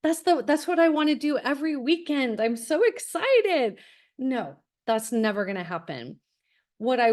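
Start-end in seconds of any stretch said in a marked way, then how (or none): 0.0-3.8 higher, gushing mock enthusiasm from a teen; mock excited teen. Parent mocking a teenager who begs for test prep, mock gushing excitement. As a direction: ham up a kid begging for test prep. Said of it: "your teenager's not gonna go".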